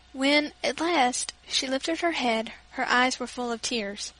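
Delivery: usual speaking voice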